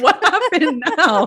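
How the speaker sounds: high, drawn-out imitation of dismay; hammed-up, laughing exasperation; mock exasperation. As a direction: stretch it out, amused, as the weary wife. Acting Melissa's exasperated reaction.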